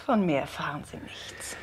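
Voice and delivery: hushed, breathy refusal; whisper. Acted. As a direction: whisper it defiantly. Zeugin verweigert flüsternd Auskunft. (geflüsterte Weigerung)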